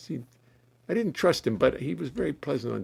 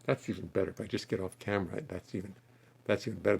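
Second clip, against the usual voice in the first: ordinary talk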